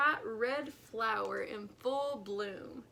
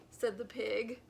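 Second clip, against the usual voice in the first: ordinary delivery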